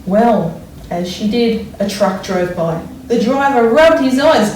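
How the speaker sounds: put-on expressive read-aloud for children; story reading. A narrator's reading. Teacher reads picture book aloud.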